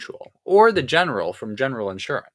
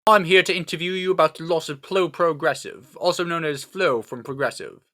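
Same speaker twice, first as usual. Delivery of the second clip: higher, louder announcement; self-important detective; 5 semitones higher, 4 dB louder than usual. Acted. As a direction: state your business as a grand investigator. Archibald announces his business.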